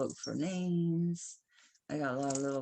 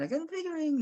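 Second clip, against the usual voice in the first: mock hopeful; 14 semitones higher, faster than usual. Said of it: "I keep getting rings thinking".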